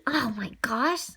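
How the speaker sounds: breathless whispered relief; relieved whisper to herself. Acted. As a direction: whisper it to yourself, softly. Relieved aside.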